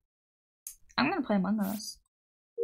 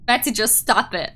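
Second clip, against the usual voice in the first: usual speaking voice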